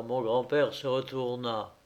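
plain reading voice

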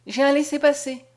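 high, pressing plea; trembling. A narrator's reading. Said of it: "dit Mariette toute tremblante".

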